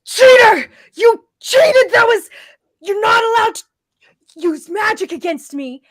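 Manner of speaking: high, loud accusing yell; shouting cheater at the player; furious shout. Acted. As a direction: scream the accusation in outrage. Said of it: "he points at you and shouts".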